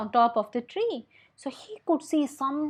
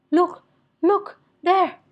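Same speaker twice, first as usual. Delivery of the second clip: higher and louder urgent cry; urgent pointing; 8 semitones higher, 7 dB louder, slower than usual. Acted. Moochwala points his staff to the window, alarmed cry. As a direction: shout it while pointing, alarmed.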